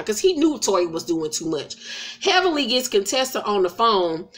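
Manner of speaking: ordinary talk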